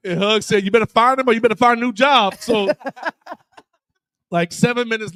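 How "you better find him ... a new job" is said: a bit louder retold warning; a touch of a fed-up head coach; mock stern threat.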